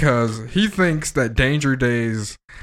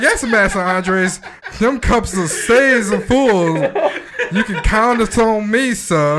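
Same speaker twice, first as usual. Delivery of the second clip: higher, slow mock servant voice with laughter; 9 semitones higher, 4 dB louder, slower than usual. Teasing his friend, a high laughing servant voice. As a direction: play the meek servant, giggling.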